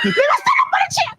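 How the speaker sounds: very high laughing shout; screaming. Retold or imitated. Mother vowing to climb the chair, high laughing shout. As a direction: shriek it up high while laughing. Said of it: "And I'm screaming like that".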